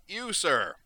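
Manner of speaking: loud, high, grand hail; expansive declaration. Acted. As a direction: point him out with theatrical flourish. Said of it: "declared expansively".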